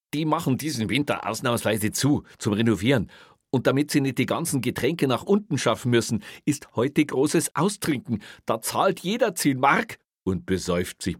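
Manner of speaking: high, loud, insistent pitch; urgent explaining. Acted. Officer explains the big drinking party to boss, urgent. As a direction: make him grasp how momentous this is.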